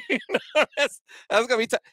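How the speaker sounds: high, loud laughing speech. Real, not acted. Recalling a player's failed dunk to co-host, laughing. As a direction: talk through a big laugh.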